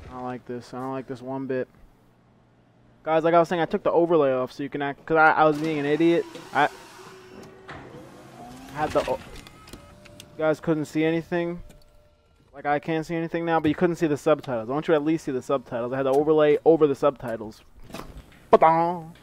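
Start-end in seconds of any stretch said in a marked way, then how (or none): 3.0-17.6 quick, loud rushed explanation; fast explaining. Real, not acted. Explaining to viewers hurriedly. 18.5-19.1 loud mock sound effect. Real, not acted.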